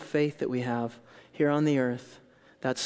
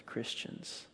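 whispered; 3 semitones lower, 15 dB quieter than usual. Real, not acted. Hushed address to congregation.

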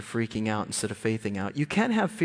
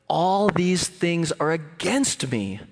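slow, louder cry of Jacob's complaint; dramatic scripture lament, higher and drawn out; acted despairing lament; 4 semitones higher, 5 dB louder, slower than usual. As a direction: draw out the lament, pitch rising.

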